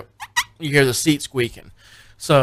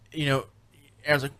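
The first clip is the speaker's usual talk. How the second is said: usual speaking voice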